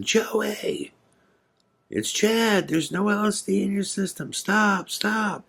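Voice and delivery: high pleading call, voice strained; yelling out. Retold or imitated. Begging drugged Joey to stop, high strained yelling. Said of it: "I'm yelling out as loud as I can".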